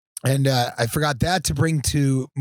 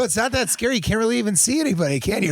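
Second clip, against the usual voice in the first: high, fast coaxing; coaxing a scared man; 8 semitones higher, faster than usual. Retold or imitated. Host coaxing a scared guest on stage, high and quick. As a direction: reassure him in a high, quick voice. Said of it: "I go".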